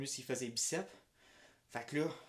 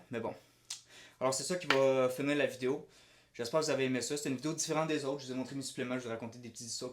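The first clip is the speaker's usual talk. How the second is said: ordinary talk, nothing marked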